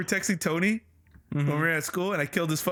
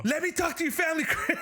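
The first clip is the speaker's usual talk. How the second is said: fast, higher, laughing mock yell; teasing yell; 9 semitones higher than usual. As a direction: shout it fast to embarrass a friend. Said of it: "Just yelling at him".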